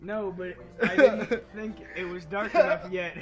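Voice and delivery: laughing. Real, not acted. Laughing after the scare.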